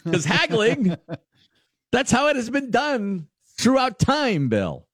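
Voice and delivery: put-on high, indignant plea of an old-world seller; outraged old-timer. Pickle seller pleading with a customer who won't haggle, high indignant whine. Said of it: "And he's like".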